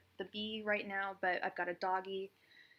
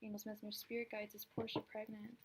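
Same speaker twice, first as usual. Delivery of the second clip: quiet invocation; 10 dB quieter than usual. Real, not acted. Repeats invocation to spirit guides.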